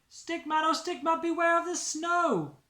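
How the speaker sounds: high, loud sing-song warning; warning refrain. Acted. Narrator warns Stickman of danger, high sing-song call.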